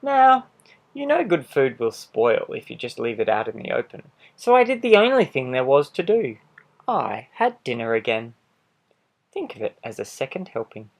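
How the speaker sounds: a lightly voiced sly wolf telling his side; charming wolf pleading innocence, animated; wolf narrator. Wolf narrating his own story.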